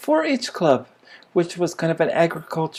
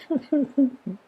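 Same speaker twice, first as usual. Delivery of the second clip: laugh; 9 semitones higher than usual. Real, not acted. Amused at the cow's fate.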